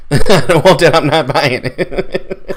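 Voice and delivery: laughing as the words come out; laughing refusal. Real, not acted. Joking to co-hosts about refusing the console, laughing. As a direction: repeat it through laughter.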